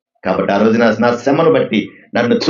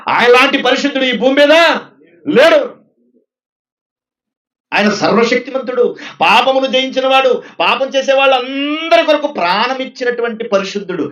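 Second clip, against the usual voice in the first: raised, ringing praise of the holy God; fervent preaching; 9 semitones higher than usual. Acted. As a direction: preach it high and full of fire. Preaching at full force. (బిగ్గరగా ఉత్సాహంతో ప్రసంగం)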